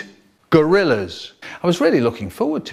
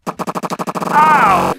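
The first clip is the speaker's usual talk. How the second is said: sharp high loud yelp; mock yelp of pain; 13 semitones higher, 5 dB louder than usual. Real, not acted. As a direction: yelp as if burned. Speck of hot jizz hits him.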